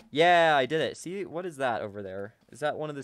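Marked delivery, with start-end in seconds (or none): 0.1-1.0 loud burst of triumph; triumphant cheer. Real, not acted. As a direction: cheer your own win loudly. Celebrates succeeding in the game.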